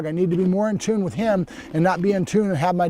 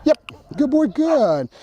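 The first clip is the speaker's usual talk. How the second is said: dog praise; 6 semitones higher, slower than usual. Real, not acted. Praising the dog during training.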